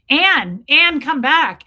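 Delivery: loud call, pleading her back. Retold or imitated. Calling Anne back.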